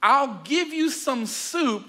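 slow, high, drawn-out taunt; singsong sly bargaining; teasing offer. Acted. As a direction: dangle it slowly before him. Jacob dangles the trade.

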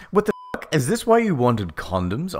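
high, incredulous sarcastic question. A narrator's reading. Narrator confronting the two she caught, sarcastic. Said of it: "I asked sarcastically".

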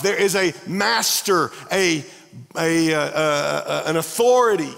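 loud, impassioned preaching. Acted. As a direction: preach it hard to the congregation. Pastor preaching at full force.

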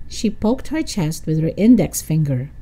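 ordinary delivery, nothing marked

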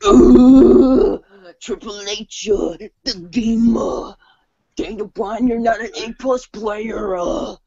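strained, grunting wrestler impression; Triple H. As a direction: start with a loud grunt, then speak slow and high. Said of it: "do an impression of Triple H now".

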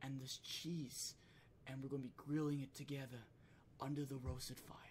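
soft, hushed mock British chef; Gordon Ramsay ASMR. Chef describing grilling cheese, mock ASMR softness. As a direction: murmur the recipe sensually, softly. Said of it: "A S M R shit".

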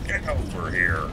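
a hint of a cattle-yelling cowboy. Shown as a demonstration. Streamer playing cowboy, calling to cattle in a mock rasp. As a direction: bark it out like a trail hand, lightly. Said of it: "Like you've been yelling at cattle all day".

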